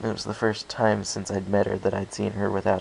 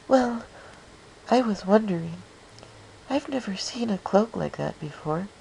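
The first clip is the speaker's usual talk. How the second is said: put-on shy pony girl; 12 semitones higher than usual. A narrator's reading. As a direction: voice a timid, gentle mare. Fluttershy speaks shyly.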